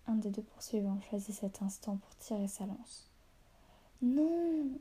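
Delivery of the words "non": loud, high, drawn-out cry; long yell of protest, pitched well up; howled shout. A narrator's reading. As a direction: howl it out in alarm. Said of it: "hurla Gésir".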